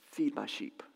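hushed whisper of Jesus. Acted. Preacher whispers Jesus's command to Peter, hushed. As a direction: breathe the words out softly.

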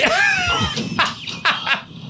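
shrieking laugh, way up high; high burst of laughter. Real, not acted. Laughs at clip.

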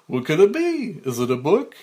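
put-on loud kids' show host guessing game; cartoonish mailman. Mike studies the gift, loud cartoonish mailman voice. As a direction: ask big, with exaggerated wondering. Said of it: "at a cartoonish Mike the Mailman".

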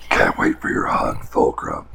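breathy macho taunt; gym bro. Acted. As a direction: taunt like a meathead. GM voices villain taunt.

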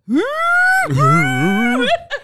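very high, loud fake howl; mock bathroom wail. Co-host retelling his bathroom cry, loud mock wail. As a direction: imitate explosive bawling. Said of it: "He went in the bathroom, he's like".

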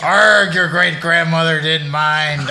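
pirate voice. Pretend. Pirate talk bit.

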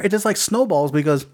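ordinary talk, nothing marked

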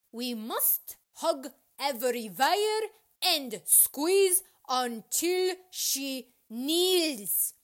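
slow, loud battle cry; yelled command. A narrator's reading. Rec cat commanding babies to squeeze teacher, slow yell. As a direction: stretch each word, high and loud. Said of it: "a rec cat yelled".

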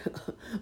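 a quick kindly laugh; brief chuckle. Acted. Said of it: "laughed Uncle Wiggily".

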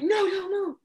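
high, loud urgent warning; retold scream. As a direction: imitate a guide yelling at surfers to freeze. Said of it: "she screams over to us".

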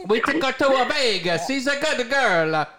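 lightly voiced Italian mobster; Italian accent. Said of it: "That's my Italian accent".

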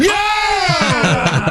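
real celebratory yell; excited cheer. Real, not acted. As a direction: cheer the win like a game show. Won the round, cheering.

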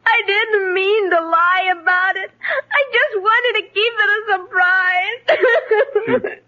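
tearful confession; crying. Acted. Girl explaining her lie to father, crying. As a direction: explain through sobs, breaking before the last word. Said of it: "please stop crying".

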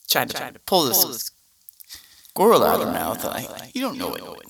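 ordinary delivery

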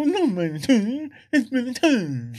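high-pitched pedant's whine; mocking a corrector. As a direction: mimic a fussy know-all in a high voice. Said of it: "somebody's gonna go".

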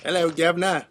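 hammy Cockney greeting; mock Cockney accent. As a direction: fake London street accent. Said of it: "have a different accent".

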